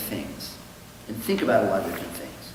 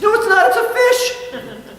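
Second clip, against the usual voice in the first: very high, slow, laughing protest; panicked denial; 7 dB louder, slower than usual. Acted. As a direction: squeak it out, caught red-handed. Goldstein denying, joke.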